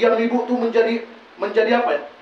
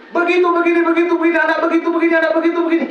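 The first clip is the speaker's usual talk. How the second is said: higher, louder mock nagging; nagging mother; 7 semitones higher, 4 dB louder, faster than usual. Mother nagging her child again, higher mock nagging. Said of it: "Malaikat tuh enak aja".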